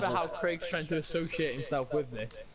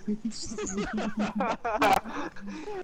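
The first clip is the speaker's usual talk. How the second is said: high, drawn-out real cackling; silly laugh; 7 semitones higher than usual. Real, not acted. Friend laughing at the selfie, silly high cackle. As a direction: keep laughing, high and helpless. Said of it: "That fucking laugh is stupid".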